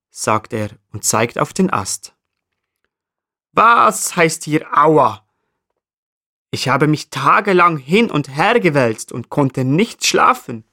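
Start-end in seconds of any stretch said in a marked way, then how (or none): 3.5-10.6 annoyed marmot. Acted. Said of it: "antwortet Eddie verärgert".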